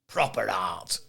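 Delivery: dad voice. Retold or imitated. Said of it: "like your dad would say".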